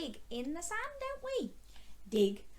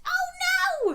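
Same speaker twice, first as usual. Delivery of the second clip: mock alarm; 9 dB louder than usual. Puppet grabs the strawberry.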